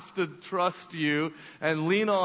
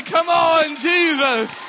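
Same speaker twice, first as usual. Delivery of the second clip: loud high call to the congregation; rally the room to shout; full-voiced praise shout; 9 semitones higher, 8 dB louder, much slower than usual. Real, not acted. Preacher calls congregation to shout.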